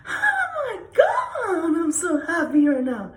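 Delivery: squealing, high, loud happy outburst; overjoyed. Shown as a demonstration. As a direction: stretch the first words into an ecstatic squeal. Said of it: "So we got happy energy".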